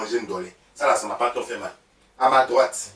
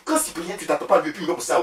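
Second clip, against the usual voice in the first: ordinary talk, nothing marked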